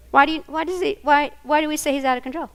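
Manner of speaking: ordinary talk, nothing marked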